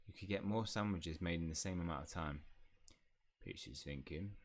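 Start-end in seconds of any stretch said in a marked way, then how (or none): 3.4-4.3 low, soft house elf pondering; hushed creature voice, rising at the end; Pitts. Acted. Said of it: "elf voice".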